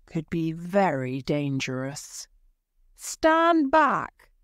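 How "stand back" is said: high, loud warning cry; cried out. A narrator's reading. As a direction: hold the crowd off with a shout. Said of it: "cried Miles".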